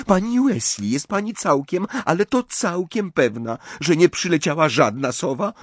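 high, fretful questioning; anxious pleading. A narrator's reading. Hermiona asks pani Weasley about owls, anxiously. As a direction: nervously press for an answer.

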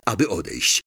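usual speaking voice

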